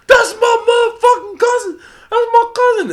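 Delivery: full-out high yell of pride; very loud, high-pitched bragging shout; hyped cousin shouting. Retold or imitated. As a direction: scream it like a proud cousin in the crowd. Retold cousin cheering him on.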